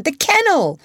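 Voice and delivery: put-on boy pointing at the old kennel, thrilled; excited cry. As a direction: shout it as you spot it. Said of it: "cried John".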